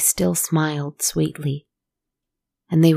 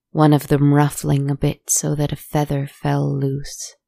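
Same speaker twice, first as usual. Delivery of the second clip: usual speaking voice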